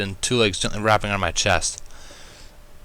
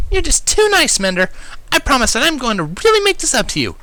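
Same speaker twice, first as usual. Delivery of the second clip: insistent Pinkie; 4 dB louder than usual. A narrator's reading. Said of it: "Pinkie insisted".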